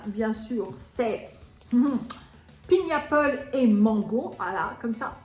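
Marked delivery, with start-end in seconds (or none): 2.7-4.3 French accent English. Shown as a demonstration. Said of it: "mon anglais si parfait".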